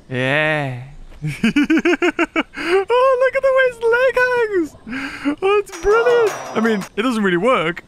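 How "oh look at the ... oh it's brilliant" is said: high, squealing laughter through words; laughing. Real, not acted. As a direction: crack up while saying it. Laughing at a dinosaur's leg.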